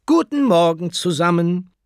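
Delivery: high, loud classroom hello; teacher's greeting. Acted. Teacher sweeps in and greets her class, ringing greeting. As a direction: project it brightly to a whole class.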